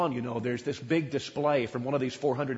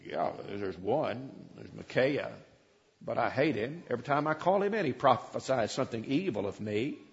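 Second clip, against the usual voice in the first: grumbling king; 4 semitones lower, 7 dB quieter than usual. Acted. Ahab grumbling about Micaiah.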